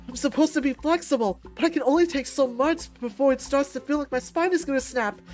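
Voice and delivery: loud, high, pained complaint; strained protest. Acted. Fighter whose spine is gonna snap, strained protest. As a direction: protest loudly as if being bent too far.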